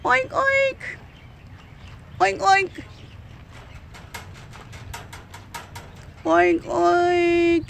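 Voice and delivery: high oink oink like a pig; pig oink imitation. Oinking at the sick pig to get its attention, high mock pig sound. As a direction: squeal the oinks at the pig.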